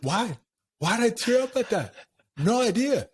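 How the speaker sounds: high, incredulous self-questioning; amused disbelief. Real, not acted. Guest baffled at tearing up over Top Gun, high amused disbelief. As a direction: squeak out the question, baffled at yourself. Laughing at own tears.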